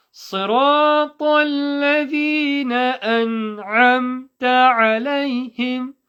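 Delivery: high, sustained melodic chant; slow chanted verse; Quranic recitation. Shown as a demonstration.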